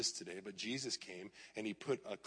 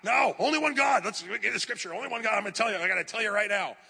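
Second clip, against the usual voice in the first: much higher, loud, fervent preaching imitation; mock eighties preacher; 9 semitones higher, 7 dB louder than usual. Pastor mocks eighties teaching against other gods, fervent. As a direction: ham up the old-school rebuke. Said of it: "what I was taught to do in the eighties".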